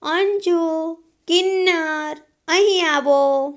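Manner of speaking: loud, high, drawn-out call. Acted. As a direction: shout for the children to come. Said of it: "બૂમ મારીને".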